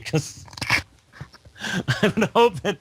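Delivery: high, slow words through laughter; laughing. Real, not acted. Host on a viewer asking when it starts, laughing. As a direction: laugh your way through the line.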